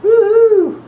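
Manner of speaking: high, loud whoop of joy; excited cheer. Real, not acted. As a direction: cheer the good news. Celebrating a good result.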